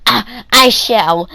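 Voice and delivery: mock posh voice. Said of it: "Philip sees this and he's like".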